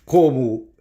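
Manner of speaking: ordinary delivery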